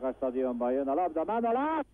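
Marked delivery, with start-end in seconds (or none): 1.2-1.8 excited. Real, not acted. Attacking moment.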